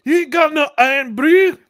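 high, loud mock Scottish; Scottish accent. Player demanding Iron Brew, high mock Scottish accent. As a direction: pitch it way up in a put-on Scots accent. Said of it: "a Scottish accent".

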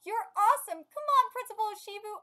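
high, loud admiring cheer; crowd cheering. Acted. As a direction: act a fan urging on the hero. Acts crowd cheering the principal.

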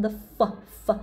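ordinary talk, nothing marked

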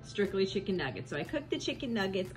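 ordinary delivery

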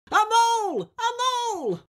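high, shrill-pitched battle yell; war cry. A narrator's reading. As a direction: charge in yelling the name. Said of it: "shouting his awful war cry".

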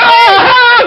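high, loud burst of yelps; mock scream. Shrieking at a stranger on the video chat, mock scream. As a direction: yelp out in fake alarm.

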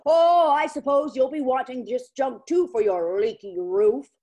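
loud hollered complaint; hollering. Acted. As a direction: holler it at the door. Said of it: "and hollered".

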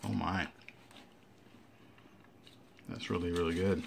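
talking with a mouth full; chewing while talking. Real, not acted. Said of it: "Shouldn't talk from my mouth full".

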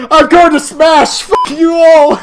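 loud, high battle yell; shouted outburst. Pretend. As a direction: yell it slowly and forcefully. Sudden outburst during the game.

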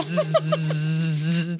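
long, low vibrating buzz; imitated buzzing. Friend retelling what she heard in her suitcase, imitated buzzing. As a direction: imitate a buzzing toy with a drawn-out zzz. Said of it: "all you hear is zzz".

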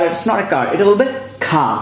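normal talking voice

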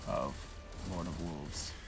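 ordinary talk, nothing marked

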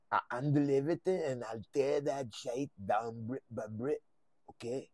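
menacing put-on mob boss voice; hammed-up crime boss threatening war; Walter Flynn. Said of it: "have you done your Walter Flynn impersonation".

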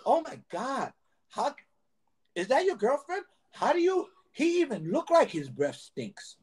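mimicking the manager. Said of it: "he would go to a guy and say".

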